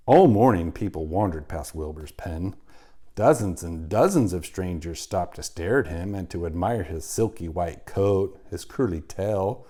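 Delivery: usual speaking voice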